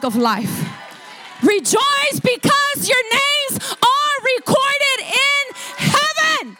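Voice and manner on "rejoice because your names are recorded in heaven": high, slow, drawn-out proclamation; full-force preaching. Real, not acted. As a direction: shout it to the congregation, stretching every word.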